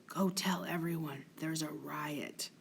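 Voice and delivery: a hushed, voiceless whisper. Acted. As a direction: whisper it so only Rodrigo hears. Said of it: "speaking so that only Rodrigo can hear".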